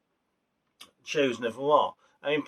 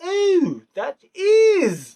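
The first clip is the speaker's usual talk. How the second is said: high, loud ooh of realising; light up as it clicks who it is; sudden realisation; 7 dB louder, much slower than usual. Retold or imitated. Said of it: "and I was like".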